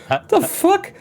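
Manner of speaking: very high laughing what the fuck; imitating the professor's disbelief; baffled professor. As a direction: squeak it out in disbelief. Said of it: "and be like".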